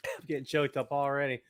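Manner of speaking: ordinary delivery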